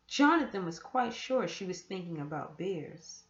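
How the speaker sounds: usual speaking voice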